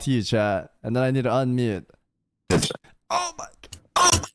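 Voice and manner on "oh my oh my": ordinary talk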